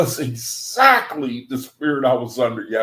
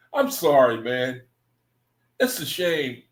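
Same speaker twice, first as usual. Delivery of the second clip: normal talking voice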